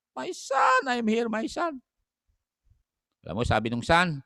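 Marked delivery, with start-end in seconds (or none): 0.1-1.8 high mother voice. Retold or imitated. Pastor voices the mother at gallows.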